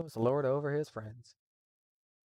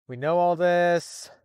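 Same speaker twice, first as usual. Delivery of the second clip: exasperated; 4 semitones higher, 7 dB louder, much slower than usual. Real, not acted. Exasperated at recap, said twice.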